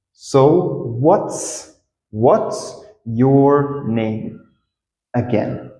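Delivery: very slow, word by word; slow, stretched-out teaching demo; slow model. Shown as a demonstration. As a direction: draw out each word for learners. Teacher models the phrase slowly.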